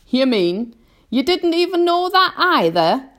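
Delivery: loud, high centipede jeer; scornful. A narrator's reading. Centipede mocks James's ignorance, scornful. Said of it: "the centipede said scornfully".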